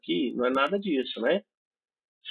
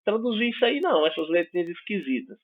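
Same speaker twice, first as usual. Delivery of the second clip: higher-pitched imitation of a puzzled student; 6 semitones higher than usual. Student protesting to the professor about strange letters, puzzled. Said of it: "vocês vão se perguntar".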